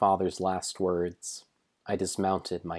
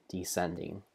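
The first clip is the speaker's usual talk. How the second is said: "descending" dropped much softer, ending on a lift; fading descending; 9 dB quieter than usual. Shown as a demonstration. Narrating spiders dropping down, trailing off softly. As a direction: let "descending" sink away quietly, tipping up at the end.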